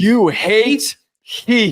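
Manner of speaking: drawn-out, ribbing; slow teasing accusation. Real, not acted. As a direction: stretch it out to needle him.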